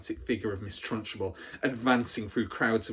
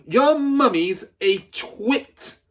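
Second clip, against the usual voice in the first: much higher and louder, slow; bellowing; 11 semitones higher, 9 dB louder, much slower than usual. Acted. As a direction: bellow the insult. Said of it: "the Trunchbull bellowed".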